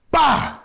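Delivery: loud high "Bah!" for a fist hitting the face; punch sound effect. Retold or imitated. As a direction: blurt out the impact of a punch.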